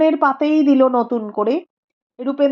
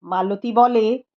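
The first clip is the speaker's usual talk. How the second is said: ordinary delivery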